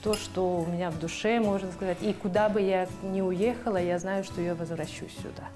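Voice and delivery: ordinary talk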